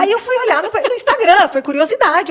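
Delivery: a real rush of excitement; excited. Real, not acted. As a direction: tell it like you can't wait to get to the good part. Excitedly telling how she checked Instagram.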